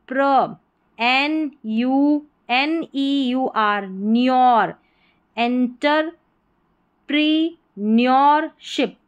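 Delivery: slow entrepreneurship, paused between "enter", "pre", "neur", "ship"; broken into parts. Shown as a demonstration. Teacher breaking a big spelling down for learners, slow and segmented. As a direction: read entrepreneurship slowly, piece by piece. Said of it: "इसको तोड़ के पढ़ें".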